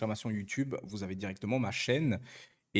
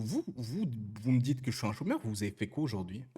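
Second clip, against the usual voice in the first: ordinary delivery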